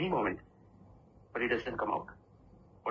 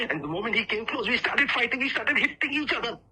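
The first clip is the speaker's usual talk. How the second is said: mounting excitement; 8 semitones higher, 4 dB louder than usual. Acted. Speaker telling audience how he and the clone came to blows, increasingly excited. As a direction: pitch and volume climbing with the fight. Storyteller builds up the fistfight.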